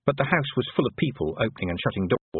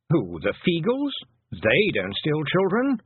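slow and meaningful; 6 semitones higher, slower than usual. A narrator's reading. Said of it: "something in the way the Toad said it".

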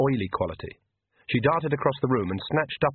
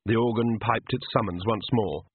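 plain reading voice

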